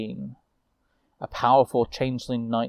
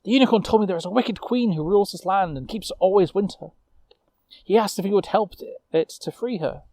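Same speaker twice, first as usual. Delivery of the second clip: put-on eager young witch; 6 semitones higher than usual. Lucille relays unicorn's tale.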